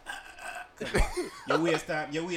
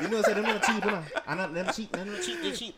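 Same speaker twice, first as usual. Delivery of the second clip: Jamaican patois, high and excited; Jamaican accent; 9 semitones higher, 3 dB louder than usual. Acted. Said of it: "the way your accent switch on a regular day".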